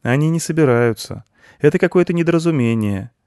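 put-on high, loud child's relief; overjoyed. A narrator's reading. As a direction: pitch it high, happily reassured. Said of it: "обрадовался мальчик". (радостный высокий детский голос)

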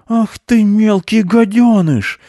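high, loud drawn-out scolding shout; furious high-voiced shout; angry shouting. A narrator's reading. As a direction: yell the insult from afar. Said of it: "кричал он оттуда мышу".

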